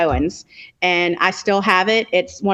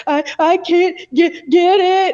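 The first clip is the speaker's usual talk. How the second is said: imitating crying; 9 semitones higher than usual. To her husband at three in the morning, imitating crying. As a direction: choke it out like a sobbing wife. Retold crying over broken turner.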